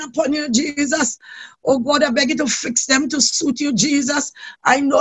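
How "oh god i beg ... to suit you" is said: ordinary talk, nothing marked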